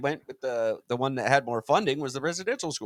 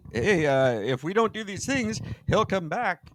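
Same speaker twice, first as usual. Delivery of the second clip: lightly acted, pitched-up appeal; comic preacher-to-friends appeal, higher; Peter urging them; 7 semitones higher than usual. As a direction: suggest it in a raised, tentative voice. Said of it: "Peter's like".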